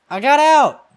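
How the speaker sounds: high, loud cry of escape; triumphant shout. Pretend. Celebrates escaping.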